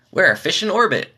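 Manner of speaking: ordinary delivery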